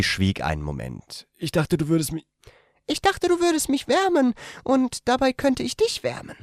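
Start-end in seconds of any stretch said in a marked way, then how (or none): 1.4-6.4 sweet and coaxing; young woman's voice. A narrator's reading.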